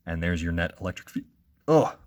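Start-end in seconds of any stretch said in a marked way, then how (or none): 1.7-2.0 a real hiccup breaking in. Real, not acted. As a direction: catch a hiccup mid-lesson.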